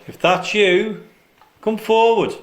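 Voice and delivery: loud call into the dark. Real, not acted. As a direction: call out to the spirit. Calling out to a spirit.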